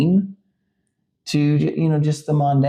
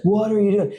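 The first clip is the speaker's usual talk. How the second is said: high, incredulous outburst imitating his wife; retold high-pitched disbelief; alarmed wife; 10 semitones higher, 4 dB louder than usual. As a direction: voice a wife's startled challenge, pitched up. Wife bursts in, alarmed at husband.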